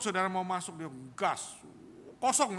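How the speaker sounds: ordinary delivery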